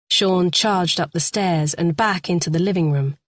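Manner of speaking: ordinary talk, nothing marked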